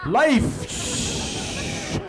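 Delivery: Life. breathed out as an unvoiced whisper; whispered echo. Narrator echoes the birth of life in a whisper. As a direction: let Life. fade away like an echo. Echoing repeated proclamation.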